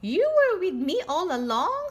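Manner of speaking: happy reveal to the seahorse friend; beaming cat talking to the seahorse; delighted. Acted. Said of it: "says Pete".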